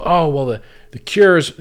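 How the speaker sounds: mocking. Mocking what people say.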